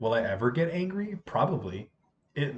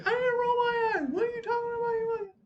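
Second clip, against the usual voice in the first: very high squealed protest; mock defensive girl. Second girl answering the accusation, high mock indignant squabble. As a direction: imitate a student arguing back in a squeal. Other girl argues back, retold.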